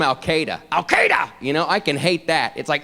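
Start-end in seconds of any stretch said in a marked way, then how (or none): 0.7-1.3 high, harsh bark of a word; barked name. Retold or imitated. Comic mimicking how the president says it, high bark. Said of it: "It's like a dog barking".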